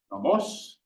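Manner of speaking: nomos with a sharp rising pitch on the accent; sharp pitch rise. Shown as a demonstration. Teacher demonstrating a sharp rise, rising nomos. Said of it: "denote a sharp rise in pitch".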